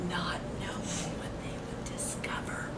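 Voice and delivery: suspenseful storybook whisper. A narrator's reading. Reading to children, whispering the bears' creeping. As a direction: hush it, voiceless.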